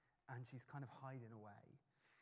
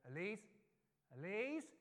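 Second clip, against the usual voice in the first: calling her name louder, rising and higher; 6 semitones higher, 7 dB louder than usual. Retold or imitated. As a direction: call out across the shop, more urgent. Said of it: "talking a bit louder and having to go".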